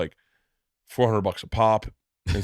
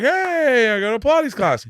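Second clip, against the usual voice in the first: mock cheer; 12 semitones higher than usual. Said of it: "everyone's like".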